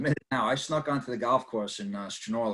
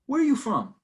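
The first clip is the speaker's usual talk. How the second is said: suspicious steward; 12 semitones higher than usual. Retold or imitated. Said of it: "So he goes".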